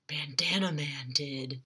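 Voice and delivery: slow, soft hushed whisper; secret whisper. Retold or imitated. James telling Logan who locked Bitters away, whispered. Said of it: "And James says".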